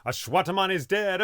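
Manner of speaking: calling out the news, not yet at full force; first call of the news, rising; starting to shout. Acted. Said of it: "began shouting".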